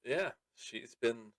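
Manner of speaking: ordinary delivery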